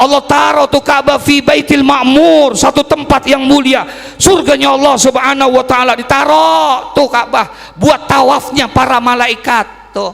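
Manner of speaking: high, sustained, ringing storytelling; full-force preaching. Real, not acted. Preacher telling how the Ka'bah was created, full force. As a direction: proclaim the story to a big congregation.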